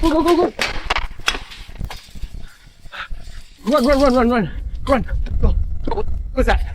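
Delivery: urgent high fleeing shouts; panicked. Real, not acted. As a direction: run for your life. Fleeing something in woods.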